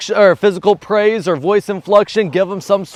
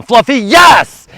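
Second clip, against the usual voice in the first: slow, loud yes; release shout; 6 semitones higher, 8 dB louder, slower than usual. Real, not acted. Owner releasing poodle to retrieve, slow loud shout. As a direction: draw out the yes as a release call.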